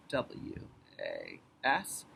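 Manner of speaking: low, quiet, drawn-out letters; slow, soft letter by letter; slow spelling while writing. Shown as a demonstration. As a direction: murmur the letters as you write them. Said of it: "So I'm gonna write that down".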